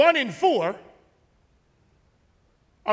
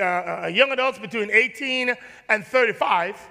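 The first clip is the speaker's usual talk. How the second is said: usual speaking voice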